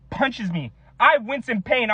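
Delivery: ordinary talk, nothing marked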